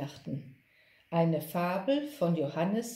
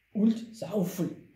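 ox voice; 3 semitones higher, 4 dB louder than usual. A narrator's reading. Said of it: "ergänzte der Ochse".

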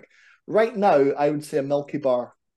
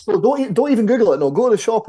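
excited; 8 semitones higher, 7 dB louder than usual. Real, not acted. Excited urging.

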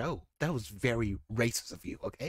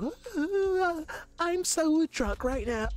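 put-on high giddy drunk girl voice; party girl drunk; 13 semitones higher, slower than usual. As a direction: squeal it like a tipsy party girl.

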